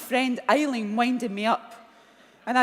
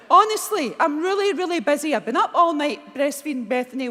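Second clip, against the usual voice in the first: louder, higher opening, acted-out impatience; exasperated brush-off; 4 semitones higher, 6 dB louder than usual. Worn-out new mother brushing off supposed prank caller, exasperated. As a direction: retell it fed up, hitting the first words hard.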